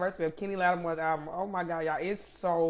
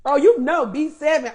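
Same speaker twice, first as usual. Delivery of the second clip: bursts out loud with delight; a sudden happy cry; excited outburst; 8 semitones higher, 10 dB louder than usual. Real, not acted. Host gushing to her live viewers, loud and excited.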